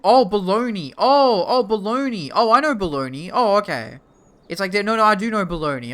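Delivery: high, loud burst of recognition; excited realization. Real, not acted. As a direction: finally get it and light up.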